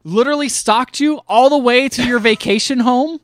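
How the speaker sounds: high, loud, worked-up rhetorical question; incredulous argument. Real, not acted. Host defending Dreyfus's hatred of a stalker, loudly incredulous. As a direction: argue it with rising outrage.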